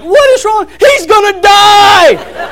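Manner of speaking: high, loud, slow panicked shriek; hammed-up frantic mother; panicked mom. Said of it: "Casey's like".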